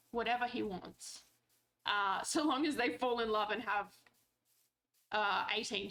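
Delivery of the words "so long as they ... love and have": ordinary talk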